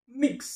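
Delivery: मिक्स said high and crisp; word model. Shown as a demonstration. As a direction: clear model of मिक्स for students. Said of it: "शब्दाचं वाचन करा". (मिक्स स्पष्ट उच्चार)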